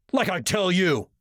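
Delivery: high mocking scoff. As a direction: sneer it out like it's a joke. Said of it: "he scoffed".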